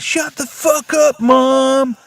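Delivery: high, loud mock yell at his mother; rude yell at mom. Son yelling at his mother, mock high yell. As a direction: snap it at mom like a bratty son. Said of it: "talks to his mother like shit".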